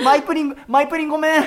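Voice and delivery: high, loud mock-tearful sorry; hammed-up apology; laughing apology. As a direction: beg forgiveness while laughing. Host apologises to the idol.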